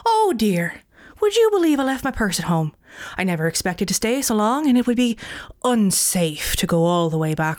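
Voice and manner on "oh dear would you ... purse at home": breathy high mock surprise; hushed, high, put-on surprise; feigned dismay. A narrator's reading. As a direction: fake an innocent oops. Jack feigning surprise to barkeep.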